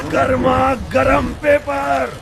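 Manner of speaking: high, slow hawker's singsong; drawn-out vendor's call. Acted. Newspaper boy advertising hot news, drawn-out street cry. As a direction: stretch each word out for passers-by.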